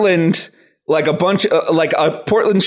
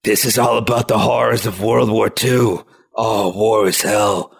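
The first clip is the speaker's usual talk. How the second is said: low, grave war-drama voice; somber Gundam; 10 semitones lower than usual. Acted. Serious Gundam on horrors of war, low solemn gravity. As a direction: intone it gravely, pitch dropped. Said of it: "they'll be like".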